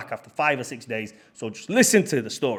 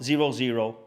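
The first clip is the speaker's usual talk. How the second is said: ordinary talk, nothing marked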